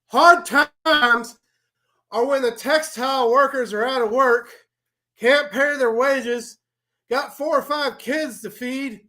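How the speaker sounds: high, loud wrestling promo rant; Dusty Rhodes promo. Acted. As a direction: preach it like a wrestler cutting a promo. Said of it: "I'm gonna go ahead and, uh, appease the masses".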